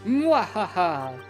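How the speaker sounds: slow theatrical villain cackle; evil villain laugh. Acted. Villain laugh in stage play.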